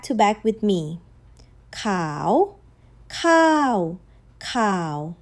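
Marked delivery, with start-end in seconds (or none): 1.7-2.6 khao from low lifting up; rising tone. Shown as a demonstration. 3.1-4.0 khao starting high and dropping; falling tone. Shown as a demonstration. 4.4-5.2 khao deep and soft, sitting low. Shown as a demonstration. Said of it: "then low".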